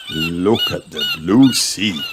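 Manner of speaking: slow, high, awed exclamation; gasping. A narrator's reading. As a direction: gasp at the view in wonder. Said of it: "gasped Daddy Pig".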